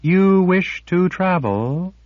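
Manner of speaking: high, slow wondering rise; puzzled question. A narrator's reading. Sliff asking Nietzsche, puzzled. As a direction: ask in eager disbelief. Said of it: "the sliff puzzled".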